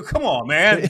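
loud, high come on man; exasperated shout. Retold or imitated. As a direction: throw up your hands and shout it. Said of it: "I go".

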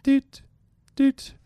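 short, high electronic "doot"; clock beep imitation. Imitating the countdown clock from the show, high mock beeps.

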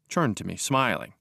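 ordinary delivery

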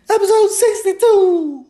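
high-pitched cartoon squeal; squeaky hype voice. Squeaky hype shout of episode.

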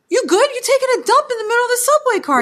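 high, fast incredulous question; mock concerned. On the subway, asking the man in fast, high disbelief. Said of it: "I go".

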